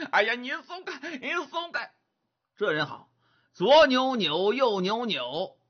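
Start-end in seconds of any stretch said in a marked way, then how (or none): 0.1-1.9 much higher, whining plea to let go; desperate whine. Acted. Young man squirms in Monkey's grip, high whining plea. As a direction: squeal to be let go.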